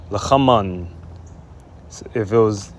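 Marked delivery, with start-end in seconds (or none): 0.1-0.9 'lachaman' joined up, throaty ch, final n; Hebrew feminine form. Shown as a demonstration. Teacher puts feminine form back together, 'lachaman' ending on n. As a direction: say 'lachaman' as one falling word. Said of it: "you would say".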